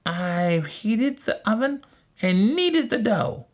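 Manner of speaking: high, drawn-out witch voice; old witch. A narrator's reading. Old witch luring Gretel, high slow voice. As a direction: draw it out as a scheming witch. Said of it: "said the old witch".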